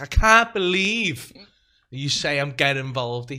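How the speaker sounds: loud, drawn-out indignant cry; hammed-up indignant shout; mock offended outburst. Fiancée offended, retold outburst.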